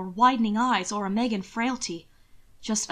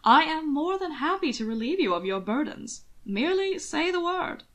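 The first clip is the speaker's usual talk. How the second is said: higher, gloating offer; gleeful; 7 semitones higher than usual. Acted. Anthony gleefully needles Hannibal, higher pitched. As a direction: savour needling your cousin. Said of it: "gleeful with all the hounding".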